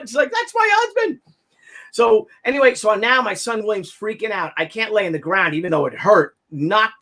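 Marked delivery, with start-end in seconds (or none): 0.3-1.2 very high shocked cry; shocked realization. Retold or imitated. Wife seeing her husband bleeding on ground, shocked high cry. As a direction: gasp it as you spot him.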